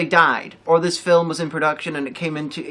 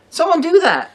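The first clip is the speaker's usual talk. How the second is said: excited call; 12 semitones higher, 7 dB louder than usual. Real, not acted. Calling on companies.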